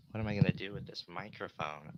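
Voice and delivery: normal talking voice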